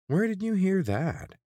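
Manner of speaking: high, loud, slow nervous question; a jumpy guard caught off guard; startled nasal guard. A narrator's reading.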